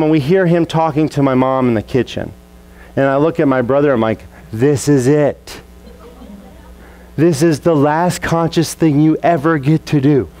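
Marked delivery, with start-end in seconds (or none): 4.5-10.3 mock ominous. Teasing the brother before dad comes.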